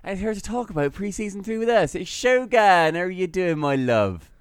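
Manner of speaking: put-on lively Norwich-accented podcast intro. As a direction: present the show and guest in a put-on Norwich accent. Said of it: "You're so Norwich, man".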